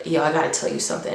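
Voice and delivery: quick and low, a hushed rush of news; hushed urgent confiding. Retold or imitated. Friend pulling the narrator aside at school, hushed and fast. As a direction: lean in and rush it out quietly.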